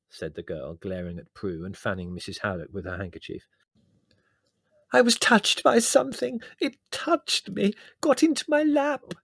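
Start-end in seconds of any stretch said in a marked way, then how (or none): 4.9-9.1 tearful. Acted. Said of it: "said Prue tearfully".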